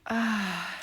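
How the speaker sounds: usual speaking voice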